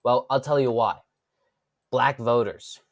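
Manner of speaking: ordinary talk, nothing marked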